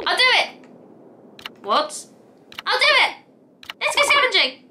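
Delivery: squeaky high, excited critter voice; eager wombat. Acted. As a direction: burst out like an overjoyed little creature. Eager wombat character agreeing.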